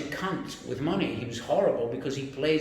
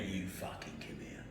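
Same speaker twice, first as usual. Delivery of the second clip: imitated boss's threatening whisper; menacing whisper; 7 semitones lower, 15 dB quieter than usual. Boss summoning his employee into the office, menacing whisper. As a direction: whisper the order, low and menacing.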